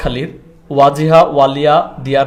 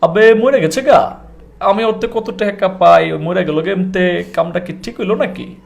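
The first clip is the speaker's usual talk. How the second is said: Dhakaiya accent, higher, slower, lively; Old Dhaka accent; 3 semitones higher, slower than usual. Shown as a demonstration. Host mimicking a Dhaka local in Dhakaiya accent. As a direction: play a street-smart Old Dhaka local. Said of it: "আবার ঢাকার লোকটা কি বলতেছে".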